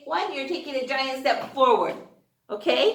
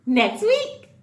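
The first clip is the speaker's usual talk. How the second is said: higher sing-song bye; bright goodbye; 10 semitones higher, 3 dB louder, much slower than usual. Real, not acted. Teacher saying goodbye to kids, sing-song high. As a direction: wave them off cheerfully.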